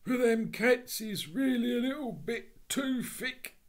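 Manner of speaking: a loud, cornered Cockney burglar. Acted. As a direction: plead like a cornered thief.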